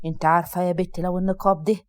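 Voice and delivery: father gripping his daughter's hand, warning her. Acted. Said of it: "بتحذير".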